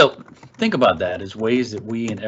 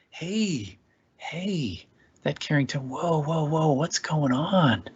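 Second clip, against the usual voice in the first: gentle enthusiastic tone; 6 semitones higher than usual. Shown as a demonstration. Parent approaching a melting-down child, gentle and higher. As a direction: coax the upset child over with a gentle lilt. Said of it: "enthusiasm and tone of voice".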